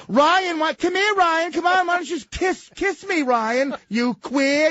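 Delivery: high loud teasing; mocking taunt. [name] mocking Ryan between takes, high and loud.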